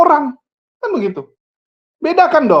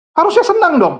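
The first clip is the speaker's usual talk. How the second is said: sarcastic jab; 7 semitones higher than usual. Real, not acted.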